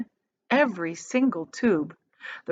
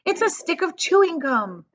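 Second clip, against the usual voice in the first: higher, eager announcement; excited girl; 9 semitones higher than usual. A narrator's reading. Violet announcing her discovery to everyone, excited. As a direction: blurt it out thrilled. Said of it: "a yell of excitement".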